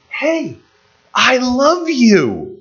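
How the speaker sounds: high, slow and tender, drawing out "love"; tender God voice. Pretend. God telling his people he loves them, high and tender. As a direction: speak as a loving father to his children.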